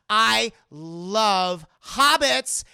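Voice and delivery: put-on swooning girlfriend. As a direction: gush over him, dragging the words. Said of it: "She goes".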